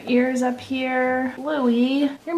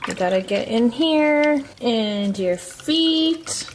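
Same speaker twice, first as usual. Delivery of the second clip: ordinary talk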